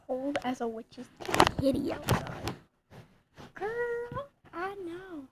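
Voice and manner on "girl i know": put-on high, drawn-out girl talk; sassy bestie reading a text; sassy girlfriend voice. Reading friend's text in character.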